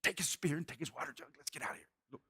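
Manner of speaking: hushed whisper as if sneaking past sleepers. Acted. Men creeping into the camp, whispering. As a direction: whisper so no one wakes.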